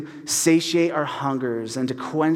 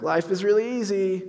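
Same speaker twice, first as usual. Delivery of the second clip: higher-pitched mock cheer; sarcastic; 5 semitones higher than usual. Pastor quoting his own text to his friend, sarcastic. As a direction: repeat the claim with raised, ironic pitch. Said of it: "me sarcastically being like".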